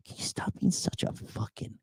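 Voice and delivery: soft, whispery mock reply; hushed retort. Imitating girls answering a whiner, hushed retort. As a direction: drop to a near-whisper, imitating disdain.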